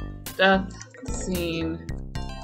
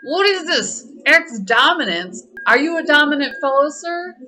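teasing; 7 semitones higher, 6 dB louder than usual. Acted. Teasing a character.

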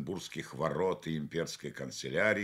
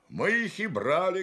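much higher, louder soldier's voice; a proud sergeant boasting; sergeant speaking; 12 semitones higher, 7 dB louder than usual. Acted. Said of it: "говорит старшина".

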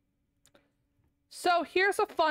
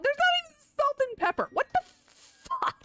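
outraged disbelief; 12 semitones higher, much faster than usual. Real, not acted. Outraged at bare spice rack.

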